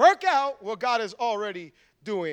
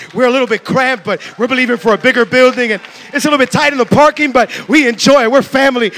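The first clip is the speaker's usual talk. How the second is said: loud, rousing sales pitch; enthusiastic invitation; 13 dB louder than usual. Acted. Church member urging a friend to come, loud enthusiasm. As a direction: build to full-voiced eager urging. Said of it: "you get around somebody that says".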